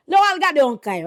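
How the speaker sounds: usual speaking voice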